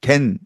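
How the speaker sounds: "get" said as "gen"; nasalised "get"; Korean mispronunciation. Shown as a demonstration. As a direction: end "get" on n, not t. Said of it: "이렇게 발음하고 있죠".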